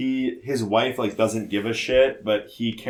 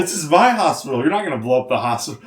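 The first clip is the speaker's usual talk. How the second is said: higher, louder hammed-up defiance; mock defiant; 4 semitones higher, 6 dB louder than usual. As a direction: stake the claim, hammed-up. Horse defends hospital.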